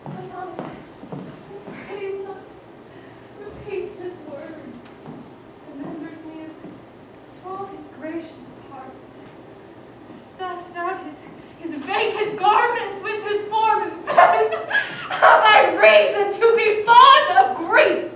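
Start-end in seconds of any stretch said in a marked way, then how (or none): 0.0-11.8 much softer, tender remembering; hushed grief. Acted. Constance remembering her child. 14.1-18.1 loud, slow, broken-laughing cry; anguished defiance. Acted. Constance defending her grief.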